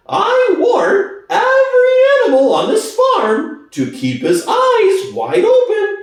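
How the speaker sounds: high, loud, ominous pig voice; impressive warning. Acted. Squealer warning every animal, loud and ominous. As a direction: warn them all with weight. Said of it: "added impressively".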